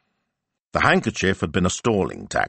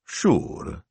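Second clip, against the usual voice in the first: lazy drawl; 3 semitones higher than usual. Acted. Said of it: "in a lazy, absent drawl".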